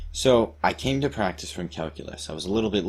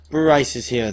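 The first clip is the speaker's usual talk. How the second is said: louder, higher mock-dramatic cry; cried and cooed; 5 semitones higher, 7 dB louder than usual. A narrator's reading. Stranded girls greet Bryce at the cliff, mock cooing cry. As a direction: call it out as girls greeting their rescuer. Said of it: "they cried, they cooed".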